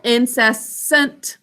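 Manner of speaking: "incessant" slowly, in separate syllables. Shown as a demonstration. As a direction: sound out "incessant" up to its last syllable. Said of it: "Next syllable".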